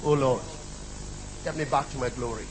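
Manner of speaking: soft, low, prayerful; quiet prayer. Shown as a demonstration. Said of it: "I'll give you an answer to that".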